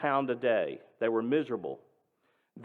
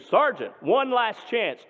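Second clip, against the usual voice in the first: louder and higher, slow on one last chance; screamed threat; 3 semitones higher, 4 dB louder than usual. Retold or imitated. Nazi major screaming a final threat at Dad. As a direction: scream the ultimatum with a pistol raised. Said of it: "And he screamed".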